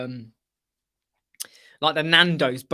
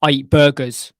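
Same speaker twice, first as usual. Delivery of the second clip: loud proud announcement of eating burgers; mock boast; 4 semitones lower, 10 dB louder than usual. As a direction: proclaim it loudly like big news. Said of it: "you kinda go".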